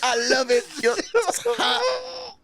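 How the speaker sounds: very high, slow Jamaican fan impersonation; high hammed-up Jamaican, breaking into laughter; Jamaican accent. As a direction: squeal it in a mock Jamaican accent, drawn out.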